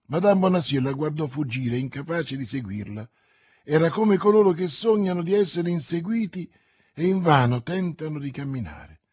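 usual speaking voice

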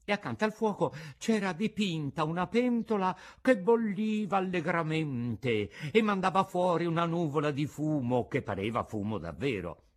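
ordinary delivery

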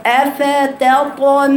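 slow, drawn-out syllables on أفتطمعون; measured model recitation. Shown as a demonstration. Teacher showing students the verse, measured model recitation. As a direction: give every syllable of أفتطمعون its full length.

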